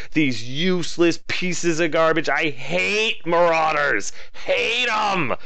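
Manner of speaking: "Hate 'em" jumps up in pitch, fed up; exasperated rant. Real, not acted. Ranting about failed Marauders.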